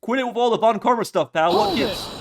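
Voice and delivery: high, loud, indignant outburst; worked-up, high-pitched protest; angry detective sidekick. Acted. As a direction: shout them down in a high voice. Detective angrily objects to rival.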